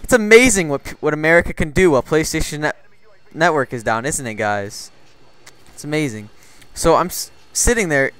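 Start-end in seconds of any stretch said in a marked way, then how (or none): none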